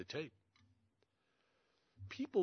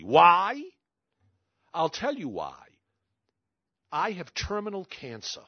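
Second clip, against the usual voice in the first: a much louder "Why?" as a retold sharp comeback; fierce retort; 3 semitones higher, 10 dB louder than usual. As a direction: throw the question back hard, then explain. Widow answers why so quickly.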